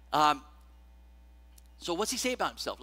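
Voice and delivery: ordinary delivery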